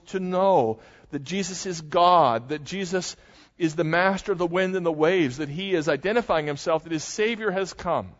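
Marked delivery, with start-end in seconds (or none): none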